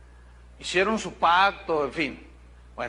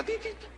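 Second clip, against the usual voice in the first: hopping rabbit noise; 8 dB quieter, faster than usual. Retold or imitated.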